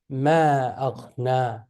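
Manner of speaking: slow, "Ma" and "aghna" both held long; unhurried demo, lingering on each long a in "Ma aghna"; long vowels. Shown as a demonstration. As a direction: stretch the a of "Ma" and the end of "aghna". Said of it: "You will say".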